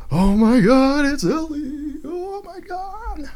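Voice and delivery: very high, sing-song fangirl squeal; mock fan excitement. Podcaster mimicking fans reacting to the trailer, mock-excited high squeal. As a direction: imitate gushing fans, stretching the final words way up high. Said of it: "in the excitement".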